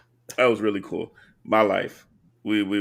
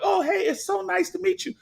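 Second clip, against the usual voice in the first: much higher, lady's greeting voice. Retold or imitated. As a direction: chirp it like the lady meeting him. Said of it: "She's like".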